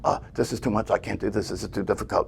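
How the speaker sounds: a soft, muttered complaint from the mind; mind's voice whining under its breath; inner doubting voice. Retold or imitated. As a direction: mimic the mind giving up, quietly. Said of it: "the voice in their head says".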